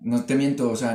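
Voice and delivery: usual speaking voice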